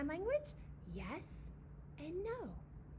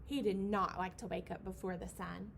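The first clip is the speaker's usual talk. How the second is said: plain reading voice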